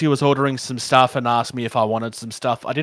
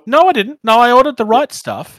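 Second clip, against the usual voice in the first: indignant protest; 10 semitones higher, 6 dB louder than usual. Acted. Mock quarrel over the order.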